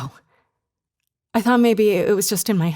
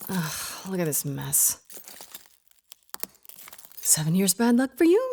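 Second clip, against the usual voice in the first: ordinary delivery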